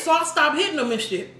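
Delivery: normal talking voice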